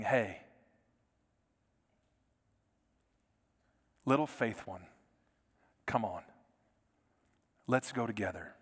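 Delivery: low, soft and gentle, arm around the shoulder; reassuring friend. Retold or imitated. Best friend comforting a worried believer, tender and soft. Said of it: "a best friend putting his or her arm around you".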